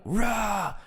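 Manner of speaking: high mock roar; cathartic roar. Speaker shows how people erupt in catharsis, high mock roar. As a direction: let out a short, high release yell. Imitating catharsis release shout.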